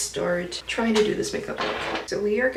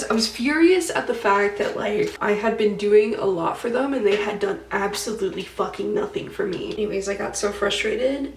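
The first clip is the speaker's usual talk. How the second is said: ordinary talk, nothing marked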